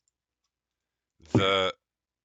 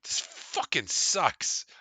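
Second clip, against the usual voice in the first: disgusted grumble; 9 semitones higher, much slower than usual. Acted. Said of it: "they taste it and they're like".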